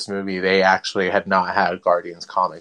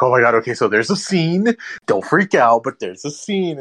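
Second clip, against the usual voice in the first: mock-anxious, pitched up on key words; retold nervous warning; 4 semitones higher than usual. Retelling a call to warn a friend about a comic, mildly panicked. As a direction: imitate breaking awkward news, voice lifting.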